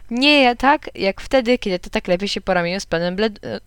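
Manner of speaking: ordinary delivery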